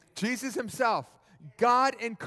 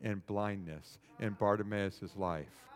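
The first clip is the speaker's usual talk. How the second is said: hushed, low, reflective preaching; tender, solemn musing; 10 semitones lower, 10 dB quieter than usual. Real, not acted. Preacher wonders about Bartimaeus's deeper hurt, hushed and tender. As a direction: bring it right down, low and gentle.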